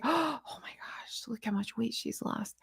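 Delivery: breathy, hushed mock awe; gasping admirer. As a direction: gasp it like an awestruck fan.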